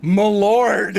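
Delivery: high, loud and grand; grand address. Pretend. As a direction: proclaim it like a herald. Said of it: "I always start it with".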